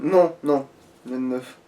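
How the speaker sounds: ordinary talk, nothing marked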